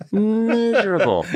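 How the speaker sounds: "Miserable" said high and slow through a laugh; laughing repeat. Shown as a demonstration. Telling a podcast host about Greek songs, laughing on "Miserable". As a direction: laugh while echoing the word "Miserable". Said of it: "but they're miserable".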